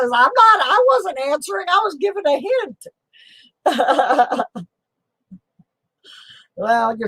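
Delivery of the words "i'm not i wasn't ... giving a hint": squeaky high mock protest; imitating a viewer's excuse in a much higher voice. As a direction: play the commenter, wide-eyed and defensive. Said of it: "Silver Nitrate says".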